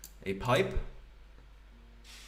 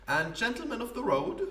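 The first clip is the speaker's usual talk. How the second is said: higher, grand theatrical poet voice; pompous poet; 10 semitones higher than usual. Acted. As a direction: play a self-important wandering poet. Poet finishes his grand title.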